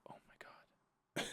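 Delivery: slow, barely audible whisper; horrified whisper. Retold or imitated. Manager learning he sneaked in, horrified slow whisper. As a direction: whisper it as if the shock is sinking in. Her horrified reaction to the reveal.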